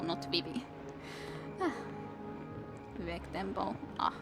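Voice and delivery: ordinary delivery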